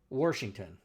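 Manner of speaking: "Washington" said as "Warshington"; added r in Washington. Retold or imitated. Quoting the old DM, "Washington" said as "Warshington". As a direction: slip an r into the first syllable of "Washington". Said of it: "he says".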